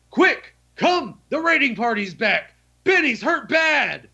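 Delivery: high, put-on panicked messenger voice, slowing at the end; fake urgent alarm. Player tricking the guard at the back door, high fake panic. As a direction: sell the lie that the raiders are back.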